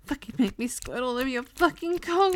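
ordinary talk

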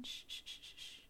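long, very soft shh; shushing the boy to settle him. Acted. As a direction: hush him gently. Calming the boy down.

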